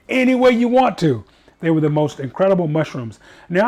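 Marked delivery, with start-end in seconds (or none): 0.1-1.2 high, loud, hammed-up excitement; retold eager answer. Diner giving the server free rein, acted excitement. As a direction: burst out as an overjoyed diner.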